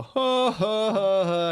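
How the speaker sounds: hammed-up scornful laughter; mock crowd laugh. Mimicking mocking crowd laughter.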